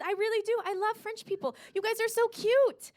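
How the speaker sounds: ordinary delivery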